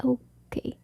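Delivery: hushed whisper. Real, not acted. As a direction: whisper it, barely voiced. Quietly finishing.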